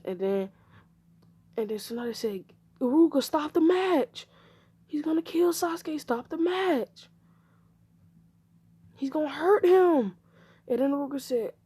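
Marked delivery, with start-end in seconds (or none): 2.8-10.2 high, alarmed pleading; urgent plea. Acted. Pleading for the match to be stopped, high urgent cry. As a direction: beg urgently, voice pitched well up. Tsunade begs to stop fight.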